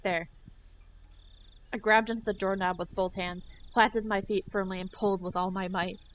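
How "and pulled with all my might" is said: usual speaking voice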